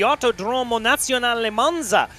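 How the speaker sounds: hammed-up Italian accent with a lifted pitch. Commentator announcing Monza to viewers in a hammed-up Italian accent. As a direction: roll out the Italian name in a self-mocking Italian accent. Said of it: "In my best Italian accent".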